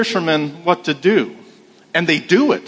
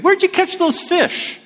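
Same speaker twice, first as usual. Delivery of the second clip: imitating a curious bystander in a much higher voice; high-pitched onlooker; 14 semitones higher than usual. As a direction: pop the question up high like a nosy passerby. Curious onlookers asking the fisherman.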